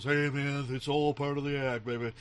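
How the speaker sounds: lightly voiced hippie rocker; a hint of a hippie brushing off a complaint; hippie musician. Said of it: "and he goes".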